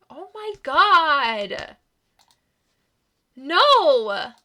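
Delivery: slow, loud, high cry of horror; horrified scream. Acted. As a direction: wail it out in shock. Heroine reacts to Eric's death.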